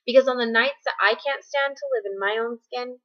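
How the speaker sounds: earnest spoken-word poem delivery; measured slam-poet cadence, pausing between images; slam poetry. Acted. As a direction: perform it in long driving phrases with punched key words. Performs own slam poetry.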